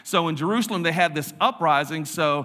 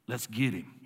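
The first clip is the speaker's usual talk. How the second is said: put-on hushed, low country-boy menace; low conspiratorial resolve; 5 semitones lower, 8 dB quieter than usual. Boys spot the costumed figure and decide to chase, hushed. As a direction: imitate boys quietly agreeing to hunt someone down.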